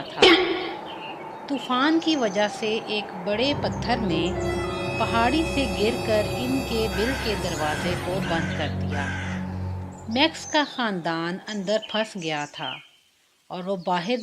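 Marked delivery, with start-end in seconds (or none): none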